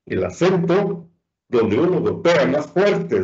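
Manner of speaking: usual speaking voice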